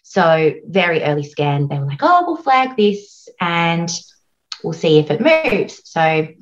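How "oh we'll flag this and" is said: ordinary talk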